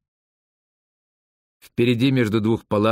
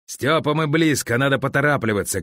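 mild urgent call, slightly raised; 4 semitones higher than usual. A narrator's reading. Boris urging Stepan on as they ride, mild shout. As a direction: hurry him on with a lifted voice. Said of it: "крикнул Борис".

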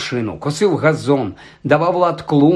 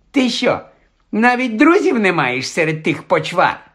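higher-pitched jeering questions; spiteful taunt; 5 semitones higher than usual. A narrator's reading. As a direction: rub it in with scornful questions. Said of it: "єхидно мовив Дадлі". (єхидно, з насмішкою)

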